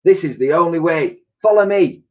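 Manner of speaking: Dotty leading them up the stairs, high; Dotty's voice. A narrator's reading. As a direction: lead the way urgently.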